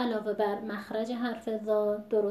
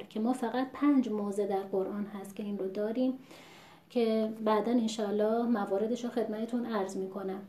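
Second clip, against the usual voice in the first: ordinary talk, nothing marked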